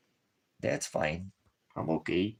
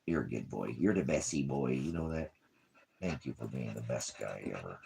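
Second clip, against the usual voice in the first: tender praise; 6 semitones lower, 6 dB quieter than usual. Real, not acted. Tender praise to his dog.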